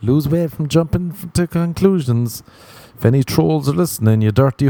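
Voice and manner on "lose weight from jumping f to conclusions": mug slogan read. Shown as a demonstration. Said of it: "put that in the mug".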